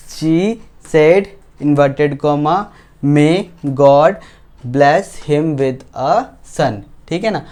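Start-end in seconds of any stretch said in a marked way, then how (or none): 3.0-6.8 very slow, spaced-out reading; slow dictation. Real, not acted. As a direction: read the example out slowly for learners. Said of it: "मैं slow slow बोलूँगा". (बहुत धीरे-धीरे पढ़कर लिखवाना)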